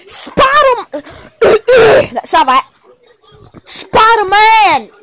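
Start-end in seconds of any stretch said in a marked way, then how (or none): 3.6-4.9 calling out. Pretend. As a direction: stretch the name out as a shout. Calling out for hero.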